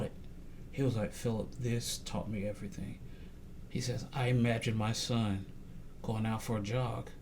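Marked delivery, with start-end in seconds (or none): 1.1-3.0 hushed, low retelling of his words; retold devastated pastor. Pastor confiding in Philip what it taught him, hushed. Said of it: "this devastated him". 4.1-7.1 subdued, low and soft quote; retold devastated pastor. Pastor imagining his son out jogging, subdued. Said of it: "this devastated him".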